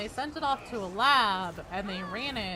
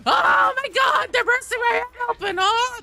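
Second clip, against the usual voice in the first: startled shout; 15 dB louder than usual. Real, not acted. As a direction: shriek it like you got jumped. Startled by loud game sound.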